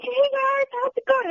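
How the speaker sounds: high, loud bubbly celebrity greeting; imitating Zachary Levi. Fan retelling the actor's call, high cheery mock greeting. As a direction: sing it out like a cheery star on speakerphone. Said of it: "He's like, he's like".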